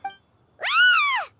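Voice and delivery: startled shout. Acted. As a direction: jump as if someone popped up behind you. Xiao appears out of nowhere.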